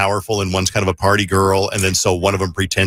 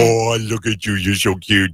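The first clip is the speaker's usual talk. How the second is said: put-on baby talk to pet. Shown as a demonstration. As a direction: coo at the dog. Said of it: "That's how I talk to Josie".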